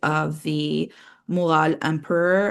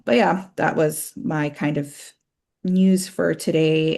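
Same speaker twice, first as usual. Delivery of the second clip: ordinary delivery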